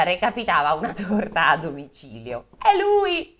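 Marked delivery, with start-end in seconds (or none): 2.6-3.4 very high, loud cry; shouted recognition. Acted. The Sperelli spotting him under the wheels, high shout. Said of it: "gridò la Sperelli".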